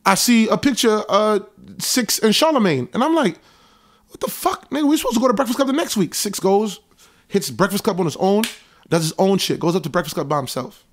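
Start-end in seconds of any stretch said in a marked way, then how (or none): 4.1-6.1 high, fast incredulous outburst; retold shock. Seeing the photo of Six and Charlamagne, high fast stunned outburst.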